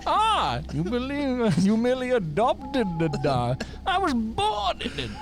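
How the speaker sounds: high-pitched, theatrical villain voice; hammed-up film villain, breaking into a laugh; Bane. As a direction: gloat grandly, stretching the words. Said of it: "Has Bane got a job".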